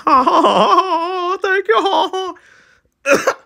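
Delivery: very high mock thank you; hammed-up flattered squeal; mock flustered. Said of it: "He's like".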